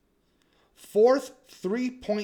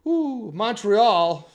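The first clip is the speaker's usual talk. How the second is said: hyped; 6 semitones higher, 11 dB louder, much slower than usual. Acted. Hyping the opponent on his vlog.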